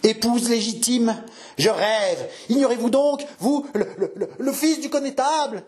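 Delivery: put-on high-pitched, astounded old man; stammering disbelief. Old father astonished at the suitor's proposal, stammering disbelief. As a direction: stumble over the words, aghast.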